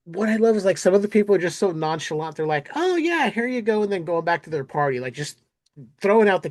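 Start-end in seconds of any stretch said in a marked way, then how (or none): 2.7-3.8 high, airy, offhand; breezy nonchalance. Retold or imitated. Podcaster voicing a casual neighbor handing out candy, breezy. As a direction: toss it off like it's nothing.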